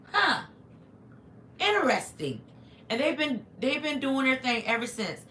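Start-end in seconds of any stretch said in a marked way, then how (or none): none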